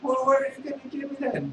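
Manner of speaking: fast high beggar voice; street beggar. Acted. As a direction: demand it quickly, high. Beggar demands something.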